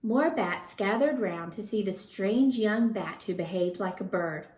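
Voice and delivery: ordinary delivery, nothing marked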